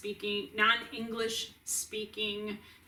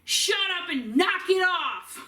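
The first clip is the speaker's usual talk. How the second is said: higher, louder, slower scolding in a put-on mom voice; imitating mom snapping; 8 semitones higher, 7 dB louder than usual. Mom in the common area shouting at the noisy resident, higher, louder, slow angry scold. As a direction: bark it out like a cranky old mother. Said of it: "turns around and goes".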